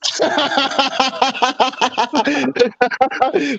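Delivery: bursting out laughing; helpless laugh at a threat. Real, not acted.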